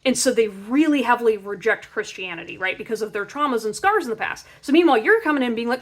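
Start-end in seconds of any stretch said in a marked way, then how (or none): none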